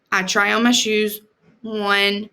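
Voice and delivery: ordinary delivery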